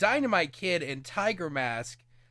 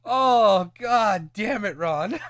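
laughing; 4 semitones higher, 5 dB louder, much slower than usual. Real, not acted. Cracking up at the list.

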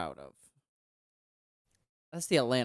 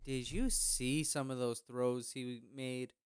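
ordinary delivery

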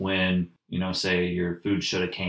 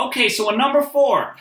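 high, loud mock order-taker; intercom lady; 7 dB louder than usual. Drive-thru lady repeating the order back, mimicked high and loud. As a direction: mimic someone confirming an order, pitched way up. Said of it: "the lady over the intercom".

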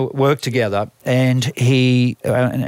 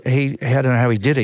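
ordinary delivery

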